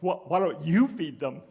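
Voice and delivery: lightly voiced Jesus with a chuckle; chuckling Jesus. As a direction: ask it half-laughing, teasing. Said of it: "kind of with a chuckle in his voice".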